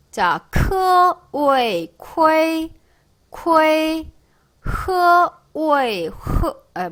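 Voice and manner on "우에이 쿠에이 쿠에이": 우에이 then 쿠에이 held on a high tone; drawn-out, level 쿠에이 built from 우에이; syllable drill. Shown as a demonstration. Teacher blending initial and final, syllable drill.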